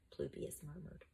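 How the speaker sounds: ordinary delivery, nothing marked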